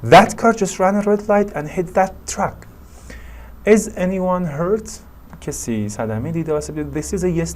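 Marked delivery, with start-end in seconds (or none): 0.0-2.5 high, loud exclamation with lots of energy; energetic. Shown as a demonstration. Teacher modelling an accident witness's exclamation, energetic. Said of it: "said with a lot of energy". 3.6-4.9 high, slow, concerned question rising on HURT; worried question. Shown as a demonstration. Teacher modelling a witness checking for injuries, worried. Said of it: "asked in a worried way".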